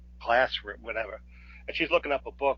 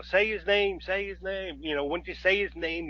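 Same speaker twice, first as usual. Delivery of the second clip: higher-pitched mock plea; retold urging; 6 semitones higher than usual. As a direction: urge someone on in a raised retold voice.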